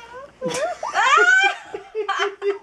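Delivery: burst of laughter. Real, not acted. Laughs during solemn game.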